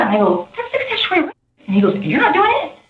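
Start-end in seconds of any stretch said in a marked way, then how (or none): 0.5-1.3 very high-pitched incredulous quote; retold shocked objection. Witness retells objecting to him, shocked. 2.0-2.8 high-pitched imitated disbelief; retold challenge. Witness imitates the man challenging her, incredulous.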